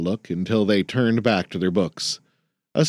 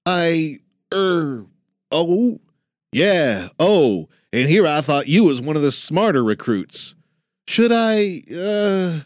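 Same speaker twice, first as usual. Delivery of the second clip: higher, stammering trainee voice; flustered young man; 7 semitones higher than usual. Acted. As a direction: fumble for words, caught off guard.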